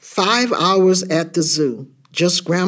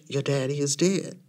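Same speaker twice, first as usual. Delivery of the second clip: much softer, near-whispered news; hushed whisper; 9 dB quieter than usual. A narrator's reading. Mama telling her child of a death, hushed. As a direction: drop right down to break it softly. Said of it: "She whispers".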